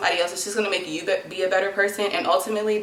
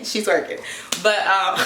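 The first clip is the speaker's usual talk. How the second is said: ordinary delivery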